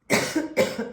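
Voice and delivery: short sharp dry cough; one dry cough into the mic. Real, not acted. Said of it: "excusez-moi".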